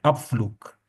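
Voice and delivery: अब फ्लूक ending on a crisp unvoiced k; final g as k. Shown as a demonstration. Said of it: "के का sound निकलता है". (अंत में साफ़ के वाला फ्लूक)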